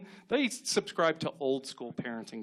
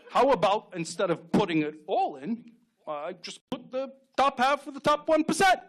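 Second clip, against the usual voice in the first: Bernie Sanders impression. Bernie Sanders propositioning a woman at a bar, comic impression. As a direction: stammer through a proposition like a campaign debate. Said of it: "can you imagine Bernie Sanders trying to get laid".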